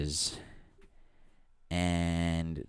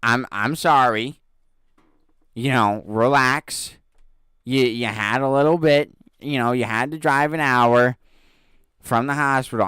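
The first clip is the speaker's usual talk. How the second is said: higher, louder soothing plea; calming the customer; 5 semitones higher, 8 dB louder than usual. Retold or imitated. Clerk calming the panicking customer, mock soothing. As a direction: talk her down, a touch higher and louder. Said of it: "I'm like".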